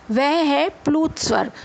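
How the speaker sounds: normal talking voice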